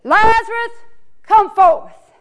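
Jesus calling into the tomb; hollered call. Acted. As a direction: summon him forth with force. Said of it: "Jesus outside of the tomb hollers".

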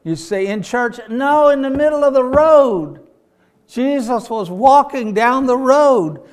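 loud, high altar call; preaching appeal. Acted. Preacher urging his friends to meet Jesus, loud and high. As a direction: press it home to them.

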